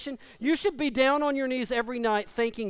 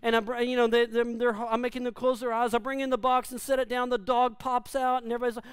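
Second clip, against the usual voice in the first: normal talking voice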